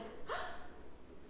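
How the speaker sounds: quick voiceless gasp of amazement. A narrator's reading. Children come home and find a puppy, gasping. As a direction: gasp in surprise.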